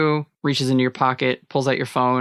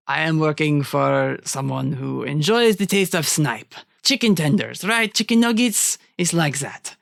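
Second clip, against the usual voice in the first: put-on lilting, higher-pitched French-accented villain; 4 semitones higher than usual. [name] explaining the snipe trade to Allie, courteous French accent. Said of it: "sounded like a genuine French accent".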